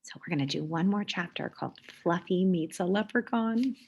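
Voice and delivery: usual speaking voice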